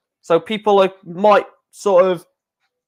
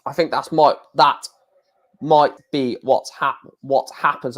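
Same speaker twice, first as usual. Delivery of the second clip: stumbling over "might be what happens"; halting false starts on "that might be what happens"; tongue-tied. Real, not acted. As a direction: trip on "might" and cut "happens" short, then retry. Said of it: "I can't even speak at the moment".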